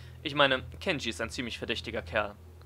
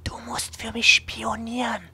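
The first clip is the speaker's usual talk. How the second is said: whispering; 12 semitones higher, 10 dB louder, slower than usual. Acted. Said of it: "kommt noch näher".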